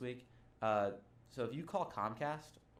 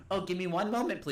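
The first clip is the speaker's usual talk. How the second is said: robot voice; 6 semitones higher, 4 dB louder than usual. Retold or imitated. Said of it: "why would they develop a fucking robot".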